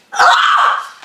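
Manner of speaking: a high, breathy squeal of delight; excited squeal. Real, not acted. Squeals at getting asked out.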